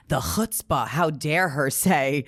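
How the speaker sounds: drawn-out, hammed-up outrage; mock indignation. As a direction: imitate someone scandalized, stretching the words. Imitating offended comics.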